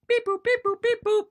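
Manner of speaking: high robotic beep boop; calculator beeps. Pretend. Fake calculator noise.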